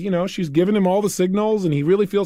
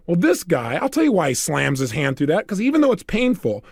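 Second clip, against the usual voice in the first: normal talking voice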